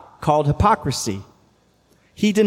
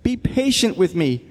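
pleading; 7 semitones higher than usual. A narrator's reading. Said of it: "begged him".

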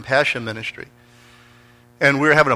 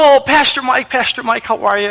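high-pitched delighted hello; imitated gushing greeting; 13 semitones higher, 5 dB louder than usual. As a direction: greet him effusively, voice way up high. Acts out lady's gushing greeting.

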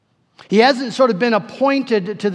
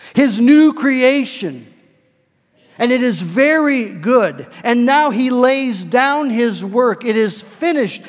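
louder, higher, slow, triumphant sermon climax; preacher at full force, slowing on every phrase; full-force preaching; 4 semitones higher, 5 dB louder, slower than usual. Acted. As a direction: proclaim it slowly, like a victory announced.

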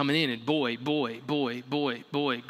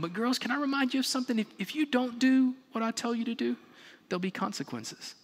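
lightly voiced king, higher and coaxing then menacing; coaxing Pharaoh; 5 semitones higher, faster than usual. Pharaoh luring the midwives with rewards, higher coaxing king. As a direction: sweeten the offer, then threaten.

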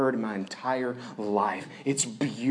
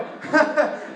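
unvoiced chuckle; 13 semitones higher, 6 dB louder than usual. Real, not acted. Laughing at the cobra line.

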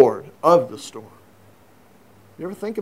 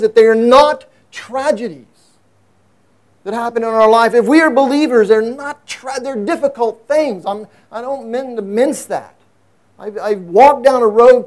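higher, louder preaching push; impassioned preaching; 6 semitones higher, 5 dB louder than usual. Acted. Pastor urging his congregation, in full-force preaching. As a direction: preach it with rising fervor.

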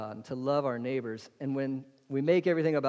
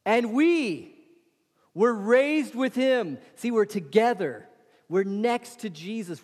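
higher, fervent preacher's delivery; full-force preaching; 6 semitones higher than usual. Acted. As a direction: preach it out to the congregation. Preacher's rising fervour.

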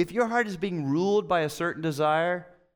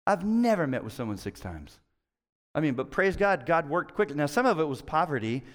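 ordinary talk

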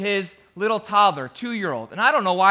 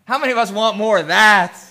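louder, rousing challenge to the room; emphatic question; 5 dB louder than usual. Real, not acted. Pastor presses the congregation for an answer, loud and emphatic. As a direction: punch it out to the congregation.

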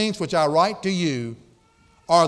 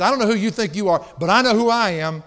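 indignant challenge; 4 semitones higher, 5 dB louder than usual. Acted. Paul asserting his authority.

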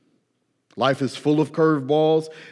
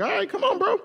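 laughing Jesus; faster than usual. Retold or imitated. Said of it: "And Jesus is like".

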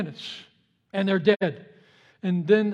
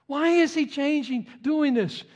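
mild higher bird voice; 7 semitones higher than usual. Acted. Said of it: "she's wondering".

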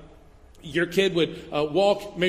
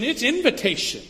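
ordinary talk

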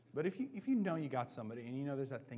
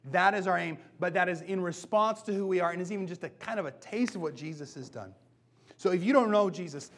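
louder, higher preaching voice, pressing each claim; a rising sermon climax; full-force preaching; 4 semitones higher, 7 dB louder than usual. Acted. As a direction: preach it out hard to the room.

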